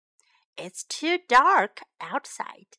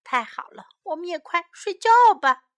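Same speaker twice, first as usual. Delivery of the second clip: usual speaking voice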